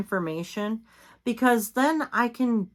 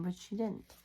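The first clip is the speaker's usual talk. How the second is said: normal talking voice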